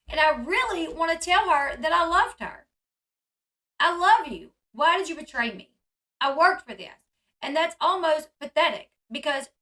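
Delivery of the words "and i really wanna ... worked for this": higher, louder retold plea; heated mock confessional, pushed up and out; impassioned quote. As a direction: imitate a betrayed husband pleading. Acting out Cody's emotional confessional.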